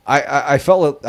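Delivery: ordinary talk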